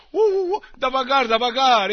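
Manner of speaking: high, loud teasing yelps; mock alarm. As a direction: yelp it out high and loud, joking. Mock cry, teasing audience.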